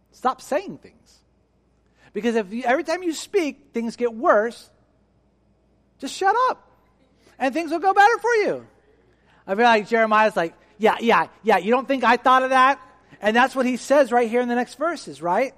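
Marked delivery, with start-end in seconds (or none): 0.1-8.7 much higher, rising exasperation; exasperated advice. Retold or imitated. 10.8-12.8 louder, higher eye-roll retort; annoyed retort. Retold or imitated. Jeremiah answering, annoyed.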